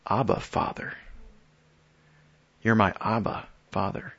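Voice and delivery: whispered, intimate Abba Father; hushed plea. Retold or imitated. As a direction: breathe it as a soft, close plea. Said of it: "he cries out".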